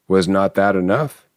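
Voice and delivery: plain reading voice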